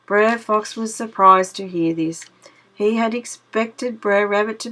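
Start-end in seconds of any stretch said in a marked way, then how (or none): none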